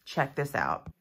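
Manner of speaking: usual speaking voice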